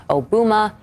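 "Obama" said as oh-BOO-muh; deliberate mispronunciation. Retold or imitated. Anchor reads out the post's typo, "Obama" mispronounced oh-BOO-muh. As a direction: say "Obama" with an oo in the middle, as if misspelled. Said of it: "my pronunciation of the spelling error".

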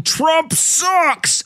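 high, drawn-out chant-like yell without real volume; mock crowd yell. Host imagines an arena crowd jeering the president, mock yell. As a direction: imitate a crowd yelling, pitched well up. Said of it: "yelling, ... at the top of their lungs".

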